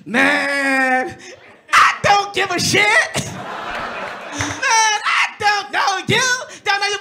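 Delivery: mock Chris Rock, high and loud, laughing at the fan; high shouty Chris Rock. Chris Rock laughs off a fan with wall posters, loud high mocking voice. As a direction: squeal it out like a mocking star.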